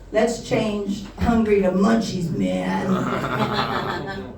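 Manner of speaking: drawn-out stoner talk, sinking on "man"; stoned guy. Acted. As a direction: play a spaced-out pothead. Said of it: "after everyone had been smoking for a while".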